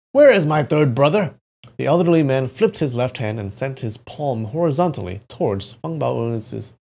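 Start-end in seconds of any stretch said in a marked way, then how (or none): 0.1-1.3 raised, forceful demand; loud questioning. A narrator's reading. Feng Bao questioning the elderly man, loudly demanding. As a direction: demand it loudly, pitch lifted. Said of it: "questioned him loudly".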